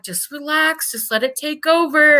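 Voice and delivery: high, gentle coaxing doctor; soothing doctor voice. Retold or imitated. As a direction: coax a patient to relax. Doctor coaxing patient to sleep.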